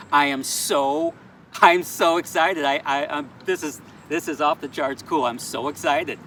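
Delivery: usual speaking voice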